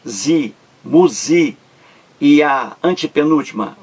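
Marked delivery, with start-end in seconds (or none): 0.1-1.6 "zi, muzi" lento, sílaba por sílaba; sílabas isoladas. Shown as a demonstration. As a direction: mostre cada sílaba de "zi, muzi" separadamente. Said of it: "a penúltima". ("zi, muzi" escandido devagar)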